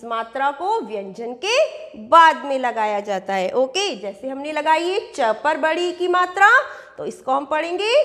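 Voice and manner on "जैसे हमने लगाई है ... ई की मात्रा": usual speaking voice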